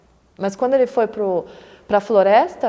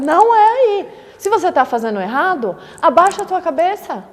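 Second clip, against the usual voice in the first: higher, louder stern rebuke; wrathful face; 5 semitones higher, 6 dB louder than usual. Retold or imitated. Dharma protector rebuking a student, wrathful and loud. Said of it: "numa face mais irada".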